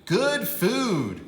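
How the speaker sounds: put-on proud little girl showing off; eager child's squeal; excited child. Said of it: "Tia said".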